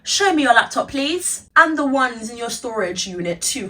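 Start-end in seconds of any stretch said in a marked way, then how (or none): none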